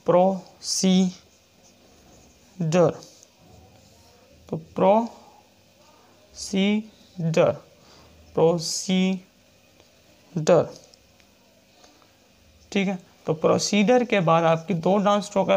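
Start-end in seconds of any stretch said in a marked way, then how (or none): 0.0-10.8 very slow, drawn-out word; teacher dictating an example; slow dictation. Shown as a demonstration. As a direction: stretch the word for the class. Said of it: "next है procedure".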